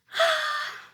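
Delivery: sharp, high-pitched gasp; excited gasp. Acted. Reader showing how excited she is for the book, high gasp. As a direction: gasp in exaggerated anticipation. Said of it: "and I am just like".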